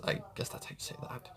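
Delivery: quick unsure whisper to herself; whispered aside. Real, not acted. Reacting to a tricky name, a quick whispered aside. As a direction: breathe it out fast, half to yourself.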